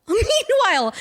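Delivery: high, sing-out "meanwhile"; dramatic transition. Real, not acted. Host moving the story on after laughing, "meanwhile" high and theatrical.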